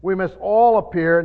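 emphatic verse reading, strong weight on "all"; stress on all. Real, not acted. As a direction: punch "all" as the key word. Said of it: "I wanna emphasize the word".

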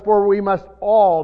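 loud reading that lands on "all"; stress on all. Real, not acted. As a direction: drive the verse up to a strong "all". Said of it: "there's your word all again".

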